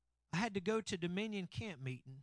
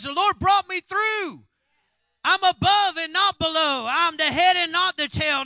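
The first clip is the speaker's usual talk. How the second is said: triumphant testimony; 11 semitones higher, 19 dB louder than usual. Retold or imitated. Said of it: "What I wanna hear was".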